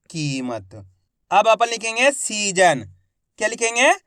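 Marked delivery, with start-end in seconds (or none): 0.1-0.7 soft low reveal. Shown as a demonstration. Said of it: "तो मिलके बन जाएगा ये".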